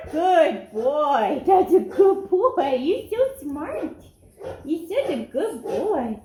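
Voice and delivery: delighted praise. Real, not acted. Trainer rewarding the dog for barking on cue, delighted praise. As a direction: gush over the dog for getting it.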